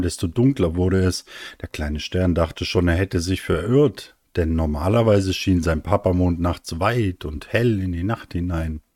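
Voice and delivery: ordinary delivery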